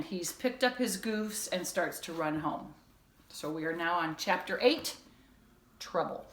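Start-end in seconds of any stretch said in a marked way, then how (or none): none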